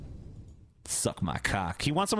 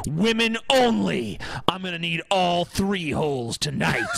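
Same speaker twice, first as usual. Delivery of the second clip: macho shouting. Pretend. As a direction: yell the ad like a sleazy tough guy. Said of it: "This is how you fucking talk to a woman".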